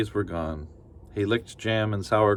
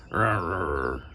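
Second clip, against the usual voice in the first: bear growl; 3 semitones lower than usual. Acted. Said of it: "growled the bear".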